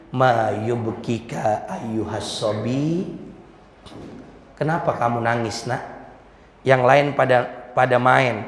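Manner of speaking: low, soft, tender questioning; gentle concern. Acted. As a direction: softly coax a crying child, pitched low. Prophet gently asks crying child. (bertanya lembut dengan nada rendah)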